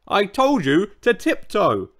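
roaring. A narrator's reading. Old Triceratops scolds the newcomer, roaring. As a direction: tell them off with force. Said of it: "he roared".

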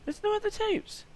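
high, lightly voiced comic squeak; squeaky silly voice. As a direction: put on a mock falsetto to joke about the tapes. Squeaky put-on voice after tape.

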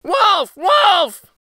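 high, loud shouted alarm; prankster's high-pitched cry; crying out in false alarm. A narrator's reading. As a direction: call out to the village in a high boy's voice. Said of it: "he cried".

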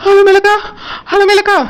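calling her name, out of breath from running; breathless calling out. Acted. Girl runs up breathless, calling out to Alamelu. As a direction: gasp her name as you arrive. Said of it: "மூச்சு வாங்க ஓடி வந்து".

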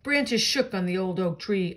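plain reading voice

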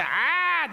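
high mock madwoman scream; hammed-up crazy yell. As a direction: daughter shrieking like a madwoman. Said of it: "הבת שלו עושה".

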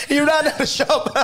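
high-pitched, breaking into laughter; laughing. Real, not acted. Storyteller on a pen thrown at him, laughing. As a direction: tell the punchline while cracking up. Laughing at his own punchline.

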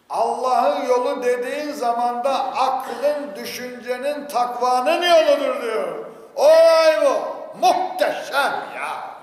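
loud, slow, drawn-out proclamation rising high; impassioned preaching. Acted. Preacher proclaiming God's path to listeners, impassioned and drawn-out. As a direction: stretch the words and build to a ringing climax.

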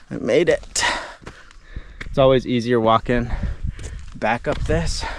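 winded after climbing; breathless from the climb; out of breath. Real, not acted. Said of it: "I'm out of breath again".

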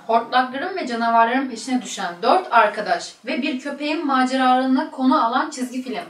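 ordinary talk, nothing marked

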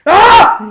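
short, high, very loud scream; startled scream. Real, not acted. Gamer attacked mid-match, startled scream. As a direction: scream out high in sudden shock.

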